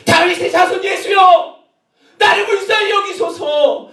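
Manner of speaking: very high, slow, drawn-out cry; shouted plea. Acted. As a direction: shout desperately for mercy. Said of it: "이렇게 소리를 질렀는데".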